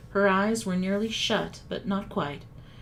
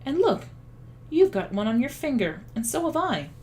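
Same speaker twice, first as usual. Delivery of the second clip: excited; 5 semitones higher than usual. A narrator's reading. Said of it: "cried Diggory".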